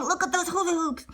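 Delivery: ordinary delivery, nothing marked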